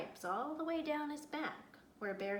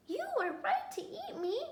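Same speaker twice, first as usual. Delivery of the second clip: squirrel voice; 13 semitones higher, 3 dB louder than usual. A narrator's reading. Cornered squirrel talking fast.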